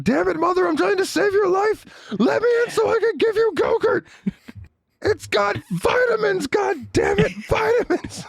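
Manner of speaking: put-on very high, screechy child tantrum; frantic daughter. Daughter trying to save mother with Go-Gurt, frantic. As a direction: scream it like a desperate little girl, hammed-up. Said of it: "she's just like".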